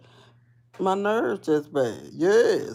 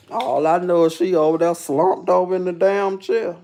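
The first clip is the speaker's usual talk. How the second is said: ordinary talk, nothing marked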